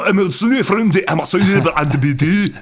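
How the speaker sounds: high, mumbled mock Scottish accent; garbled Trainspotting-style Scottish accent; hammed-up thick Scottish brogue. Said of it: "that's more Scottish".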